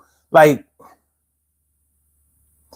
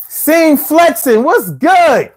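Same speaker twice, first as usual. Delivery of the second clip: excited slow greeting; 9 semitones higher, much slower than usual. Real, not acted. Greeting a caller loudly.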